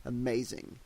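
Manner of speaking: plain reading voice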